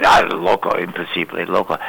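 put-on low scoffing old man; lightly voiced old man calling her crazy. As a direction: grumble it off as crazy talk. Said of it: "He says".

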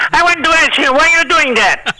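high-pitched put-on Hervé voice, puzzled; imitating Hervé Villechaize. Hervé puzzled by Shecky's dialect, high mock voice. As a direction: squeak it up high like the little man. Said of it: "he says".

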